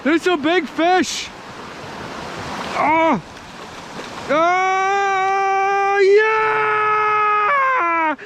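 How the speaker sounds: high, ecstatic yelling over a catch; thrilled, shouting with joy; excited shouting. Real, not acted. Landing a big fish.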